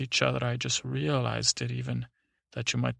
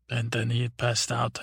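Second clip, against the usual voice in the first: ordinary delivery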